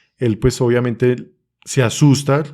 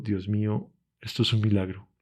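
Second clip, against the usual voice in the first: awed hushed whisper; 2 semitones lower, 9 dB quieter than usual. Retold or imitated. Baby starts breathing again.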